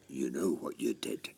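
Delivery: low, quiet menace; menacing dad, voice dropped. Acted. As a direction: threaten softly as a menacing father. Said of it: "You were very menacing".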